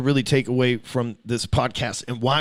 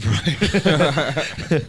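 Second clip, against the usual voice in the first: ordinary delivery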